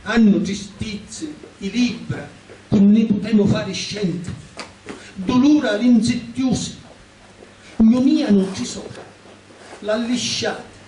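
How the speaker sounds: deliberate dialect poem reading, pitched above chat; poem recitation. Acted. Poet recites a poem written for books, in deliberate recitation. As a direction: declaim each phrase, pausing between lines. Ho scritto questa poesia.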